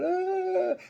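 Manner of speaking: imitated high-pitched scared cry; high mock terrified wail. Cowering inmates in the corner, mock terrified wail. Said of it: "in the corner going".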